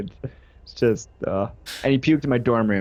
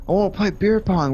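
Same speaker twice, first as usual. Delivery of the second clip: higher, hammed-up drunk friend; 7 semitones higher than usual. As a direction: play the drunk pal making his demand. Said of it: "both just so drunk".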